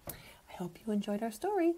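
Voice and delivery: unvoiced soft whisper. Shown as a demonstration. Teacher whispering goodbye to the children. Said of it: "whispered goodbye".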